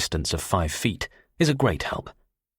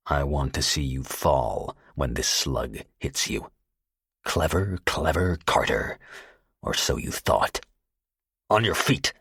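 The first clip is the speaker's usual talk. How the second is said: a killer savouring the moment, coldly; cold and vicious; 3 semitones lower than usual. Acted. Gunman threatening Carter on the floor, cold and vicious. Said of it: "No longer pleasant and affable, but cold, hard, vicious".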